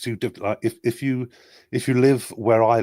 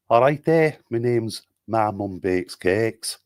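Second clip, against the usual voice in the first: lifted greeting, slow name, regional British accent; broad British regional accent; put-on regional accent; slower than usual. Shown as a demonstration. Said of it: "give one in as many accents as you want".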